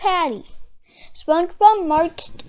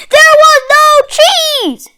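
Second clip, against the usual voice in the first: high, much louder wailing shout; anguished shout; 13 semitones higher, 12 dB louder than usual. Acted. Mister Krabs confessing the missing cheese, shouting. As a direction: blurt the disaster out at full voice. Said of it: "shouted Mister Krabs".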